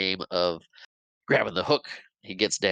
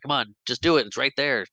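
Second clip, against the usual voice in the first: impatient urging; 6 semitones higher, 4 dB louder than usual. Retold or imitated. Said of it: "the Lost Boy's like".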